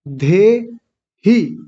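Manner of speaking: धे, pause, ही, each syllable stretched; broken into syllables. Shown as a demonstration. Teacher making it easy for learners, धे ही syllable by syllable. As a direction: split धे from ही with a clear gap. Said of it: "धे बोल करके फिर बोलें ही".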